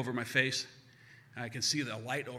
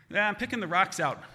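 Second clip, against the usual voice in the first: louder, higher Boston doctor voice; Boston accent; 6 semitones higher, 6 dB louder than usual. Retold or imitated. Doc explains the noise to patient, breezy Boston accent. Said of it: "he's from Boston".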